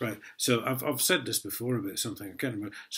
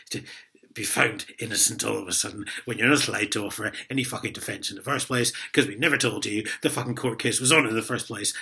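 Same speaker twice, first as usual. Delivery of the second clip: ordinary delivery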